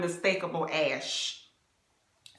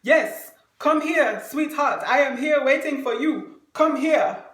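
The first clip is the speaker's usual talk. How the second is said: booming mock summons, hands on hips; loud come-here call; 5 semitones higher, 8 dB louder than usual. Date standing hands on hips calling her over, loud mock summons. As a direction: call her over loudly like a proud gentleman. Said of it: "hands on his hips".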